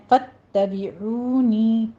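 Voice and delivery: فاتبعونی with a stressed doubled ta; slow model reading of فاتبعونی, ta pressed hard; teacher models فاتبعونی; tajweed pronunciation. Shown as a demonstration. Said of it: "تا کے پر تشدید ہے".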